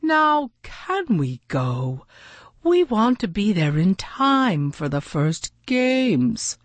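insistent, impatient character voice. A narrator's reading. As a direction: press them impatiently to get going. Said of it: "flapping his big wings impatiently".

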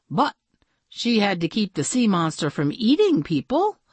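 usual speaking voice

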